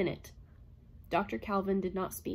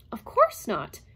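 louder, startled denial; genuine surprise; 3 semitones higher, 8 dB louder, slower than usual. A narrator's reading. Robot surprised at Calvin's question, loud and startled. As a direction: blurt it out, caught off guard. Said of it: "This was genuine surprise".

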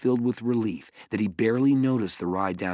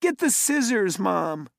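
yelling; 12 semitones higher than usual. A narrator's reading. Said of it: "Mallory yelled".

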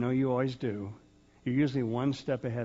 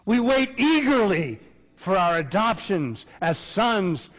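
higher and louder, ringing; impassioned proclamation; 7 semitones higher, 8 dB louder, slower than usual. Real, not acted. Preacher proclaiming to the congregation, impassioned. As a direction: declare it with full conviction.

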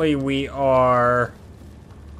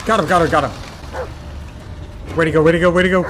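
panicking gamer; 5 semitones higher, faster than usual. Real, not acted. Frantic monster fight in game.

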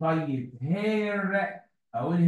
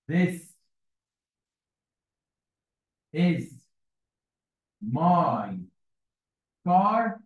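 slow dictation; much slower than usual. Shown as a demonstration. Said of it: "ممكن أقولها كده بطريقتين".

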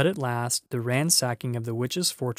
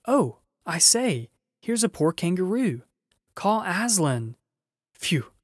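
excited, higher, louder shouts from many voices; excited searchers yelling to each other; crowd crying out; 6 semitones higher, 4 dB louder than usual. A narrator's reading. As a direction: call out eagerly through the castle. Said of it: "everyone's voices crying out at once".

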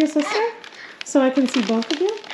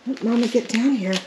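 ordinary talk

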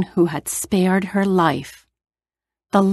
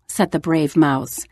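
ordinary delivery

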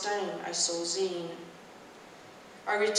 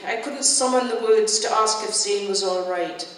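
ordinary delivery

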